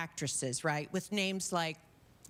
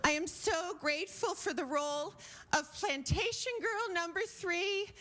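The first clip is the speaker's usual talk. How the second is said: breathy porn star speech; 9 semitones higher than usual. Acted. Porn star accepting her award, tearfully gushing. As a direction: gush like a teary starlet at the mic. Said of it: "And she's like this".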